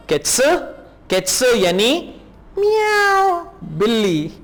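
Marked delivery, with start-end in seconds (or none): 2.6-3.3 high squeaky cat meow. Retold or imitated. Teacher mimics a cat so students grasp Katze, high meow. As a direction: mew like a kitten for the class.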